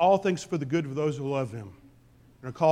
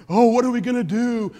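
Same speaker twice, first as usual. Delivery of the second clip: mock panic; 6 semitones higher, 8 dB louder than usual. Mock panic over national debt.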